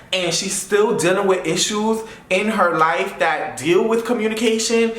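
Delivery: ordinary delivery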